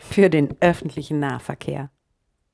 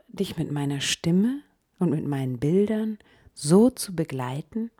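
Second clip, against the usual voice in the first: ordinary talk, nothing marked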